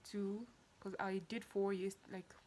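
quiet hushed talk. Real, not acted.

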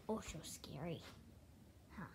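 hushed mock-scared whisper to the dog; soft spooky whisper. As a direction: breathe it like a secret. Whispering to dog about thunder.

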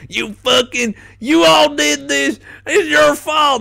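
high, loud, slow shouted accusation; mock yell. Employee imagining a bigot shouting in a Chinese buffet. Retold customer yelling.

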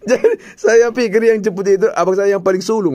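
high laughing talk through giggles. Real, not acted. Retelling the mistaken brother to Wak, laughing.

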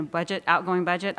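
ordinary talk

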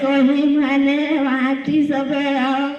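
slow, drawn-out acted lament; tearful wailing. Old mother begging someone to read her son's letter, tearful wail. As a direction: wail it slowly, stretching the words. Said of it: "શા માટે રડો છો?".

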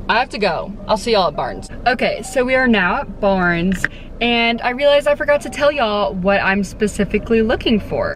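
normal talking voice

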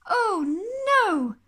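loud, high, stretched wail; exasperated Mister Bear. Acted. Sleepless bear hears aeroplane noises, loud exasperated wail. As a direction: cry it out in dismay.

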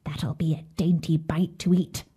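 whispered witch mutter. A narrator's reading. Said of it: "she muttered to herself".

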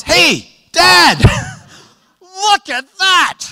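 put-on a kid calling out to dad, laughing; thrilled little boy shouting; excited son pointing. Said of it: "his son points".

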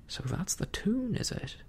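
high, knowing pirate retort; cook's retort. A narrator's reading. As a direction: answer the cheering as their captain. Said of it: "cried the cook".

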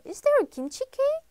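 high, loud, amazed question; can't believe kimchi cake exists; startled delight; surprised question. Real, not acted.

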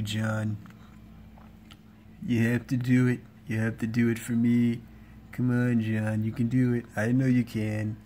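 laid-back cartoon cat, level pitch; Garfield. Cat bosses John and Odie about lasagna, Garfield impression. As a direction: play a food-obsessed cat with little rise or fall. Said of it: "I'm gonna be doing Garfield voice impression".

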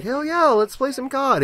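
squeaky excited gamer; high mock eagerness. Gamer misunderstanding the fun, high and eager. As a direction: cheer it in a high silly voice.

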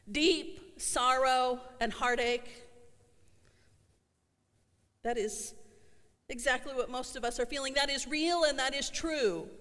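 ordinary talk, nothing marked

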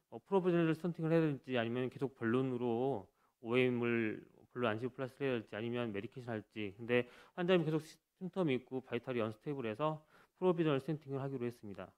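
ordinary delivery

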